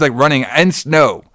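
ordinary talk, nothing marked

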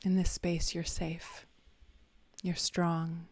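ordinary delivery, nothing marked

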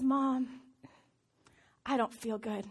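imitating a poorly little girl, softly; low, weak little voice of an ailing child; soft, feeble mock-sick complaint. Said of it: "she looks bad".

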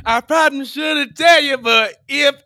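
loud, slow street voice. Pretend.

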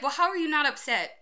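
retold exasperated question; incredulous challenge. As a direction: demand it in disbelief. Retold argument with calm husband.

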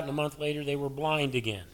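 ordinary talk, nothing marked

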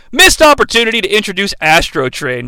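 ordinary delivery

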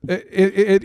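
usual speaking voice

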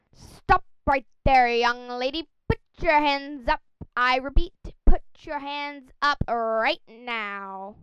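loud, slow commanding cop shout; london policeman. Acted. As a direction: bark orders like a stern bobby.

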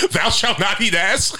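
slow, high mock scripture voice; biblical pronouncement. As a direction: intone it like old scripture. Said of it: "I think it says there's".

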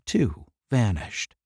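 plain reading voice

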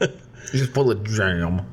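slow, mushy words through a sticky mouthful; mouth full of peanut butter. Real, not acted. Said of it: "I'm like a dog with peanut butter in his mouth".

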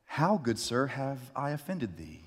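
a hint of a polite Shakespearean knight; courtly and meek, lightly voiced; gentle archaic plea; mock old-fashioned gentleman. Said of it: "this is what I heard".

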